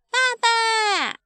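put-on high, drawn-out child's shout; calling out to daddy. Baby calls out to father. (小恐龙高声喊爸爸)

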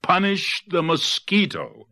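high, drawn-out crowd cry; high storybook rallying call; animals' outcry. A narrator's reading. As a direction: cry it out slowly, pitch way up. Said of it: "cried all the animals".

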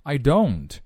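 slow, drawn-out phrases with clear linking; follow-along model reading. Shown as a demonstration. Teacher leading learners through the lyric, slow model reading. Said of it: "来跟着[name]试一下".

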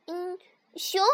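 high little fox voice; excited. A narrator's reading. Little fox tells mother what it will see, mildly excited. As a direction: say it with a cub's mild excitement. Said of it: "小狐狸兴奋地回答".

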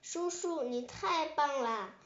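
ordinary delivery, nothing marked

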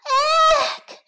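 sudden very high yelp of fright; high cry. Acted. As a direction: shriek as you're swallowed. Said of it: "生姜面包人大叫了起来".

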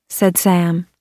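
plain reading voice

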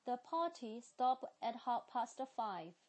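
British accent, clear teacher model; 英式发音示范句. Shown as a demonstration. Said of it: "接下来是sentences".

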